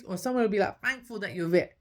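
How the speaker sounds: usual speaking voice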